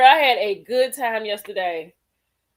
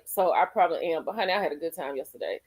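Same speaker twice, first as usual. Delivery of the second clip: normal talking voice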